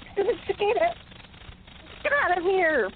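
ordinary delivery

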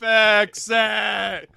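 loud barked repeat; shouted echo. Pretend. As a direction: shout it back.

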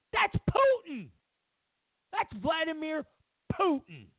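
high, sharp cry of "Putin"; mock outrage. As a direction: blurt out the culprit's name in mock alarm. Mock outraged accusation, far.

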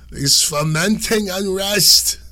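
put-on high, loud, drawn-out old Italian lady accent. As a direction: stretch it out slowly like an indignant old Italian lady, accent and all. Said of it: "that was my old Italian lady accent".

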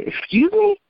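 high-pitched, incredulous retort; indignant disbelief. Retold or imitated. Retells her shocked reply to caseworker.

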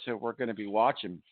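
normal talking voice

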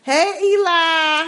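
slow, drawn-out teasing hello; playful greeting. Real, not acted. Greeting listener's husband playfully.

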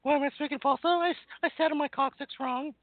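squeaky high falsetto voice; pinched, squeaky high voice. Acted. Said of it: "I'll sing in falsetto".

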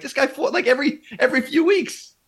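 high-pitched incredulous quote; mock disbelief. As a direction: play up the shock at his schedule. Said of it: "I'm like".

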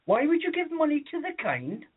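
put-on old lady confused by the kid's answer, high and puzzled; baffled woman. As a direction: repeat the odd word back in disbelief. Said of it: "And she's like".

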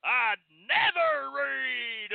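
lightly put-on country person, drawn out; country folk quote. Imagined country mechanic distrusting the car manual, mildly hammed-up country voice. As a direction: stretch it out high in mock country disbelief. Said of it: "not a one of them that says".

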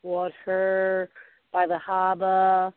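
slow Boston accent, r dropped: "water" like "wahtah", "harbor" like "hahbah". Shown as a demonstration. Host demos the Boston accent to a national audience, in Boston accent. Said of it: "we, we say".

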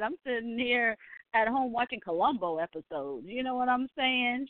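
normal talking voice

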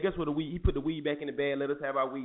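ordinary talk, nothing marked

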